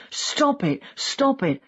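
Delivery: contained cry of protest, pitched well up; mock scream. A narrator's reading. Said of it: "Then he started screaming".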